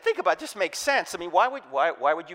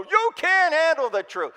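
louder, higher mock Nicholson blast; Nicholson shout; 9 semitones higher, 4 dB louder than usual. Churchgoer imitating Nicholson at the pastor, mock shout. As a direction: fling "You can't handle the truth" at the pastor. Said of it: "you just go".

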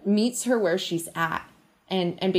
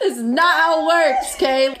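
high, louder laughing scold; 7 semitones higher, 7 dB louder than usual. Real, not acted. Friend jokingly lectures Linda, laughing. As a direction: scold playfully through a laugh.

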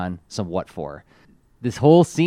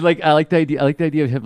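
ordinary talk, nothing marked

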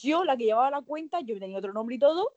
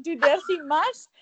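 ordinary talk

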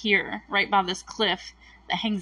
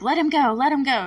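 higher, louder pleading demand; urgent plea; 5 semitones higher, 3 dB louder than usual. Retold or imitated. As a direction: repeat it pressing the bully hard. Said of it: "Mike's like".

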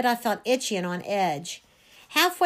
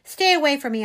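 higher, loud warning; raised, upset voice; shouted warning; 4 semitones higher, 6 dB louder than usual. A narrator's reading. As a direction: shout to keep them back. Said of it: "I shouted".

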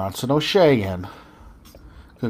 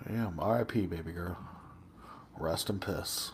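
very soft, low mock eulogy; mock solemn whisper; 7 semitones lower, 16 dB quieter than usual. Cohosts joke farewell to old teacher, mock solemn hush. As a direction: murmur it like a graveside farewell.

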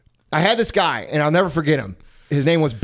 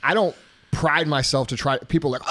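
ordinary talk, nothing marked

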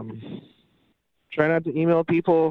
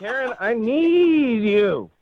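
pleading; 7 semitones higher, much slower than usual. Acted. Pleading with his date on air.

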